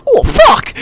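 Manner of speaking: high, loud mock yelp of dismay; shocked exclamation. Friend retells breaking the wardrobe door, high shocked yelp. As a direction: blurt it out in exaggerated shock.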